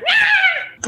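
sudden, very high, loud bird shriek; startled puffin squawk. Acted. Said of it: "in startlement".

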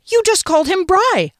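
high, loud alarmed outburst; alarmed cry. Acted. Narrator cries out at Matilda, high and loud. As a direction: cry out in shocked disbelief. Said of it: "I cried, hands jumping up to my cheeks".

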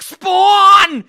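high, loud slow yell. Retold or imitated. Rob yelling the discovery at his friend Zach. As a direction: shout the names like a revelation. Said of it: "why are you yelling at me".

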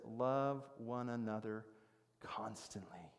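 slow, low, "constantly" dropping to a whisper; hushed slow quote. Acted. Preacher quoting Peter's command to the church, hushed slow quote. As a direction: space out "Love one another", then whisper "constantly".

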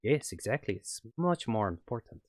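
put-on light on-and-off French accent as the villain; a French scientist, accent slipping in and out. GM as French ex-colleague on the phone, light French accent. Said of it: "excuse my terrible French accent".

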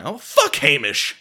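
loud, drawn-out acted retort; exasperated comic yell; dismissive outburst. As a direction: blurt it out, brushing off the objection. Dismissing the objection in acted argument.

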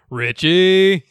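loud mock call from the back; a loud hail from behind; calling out Richie. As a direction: call his name across the room. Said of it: "George Clooney in the back going".

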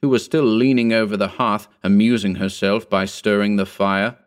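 plain reading voice

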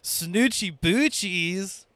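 slow, stretched-out catchphrase; catchphrase imitation. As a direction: drag out the catchphrase, quoting him. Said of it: "You heard it from the man himself".

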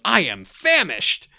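slow, drawn-out, loud I am famished; a hammed-up starving dad; mock hungry exclamation. Tim greedily answering Jill, slow and loud. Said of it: "And Tim goes".

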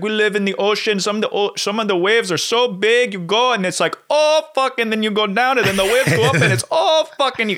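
hammed-up Italian accent, squeaking high on each Oh fuck. Retelling how the sea traveller described huge waves, mock Italian accent. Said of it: "I can't even do his accent".

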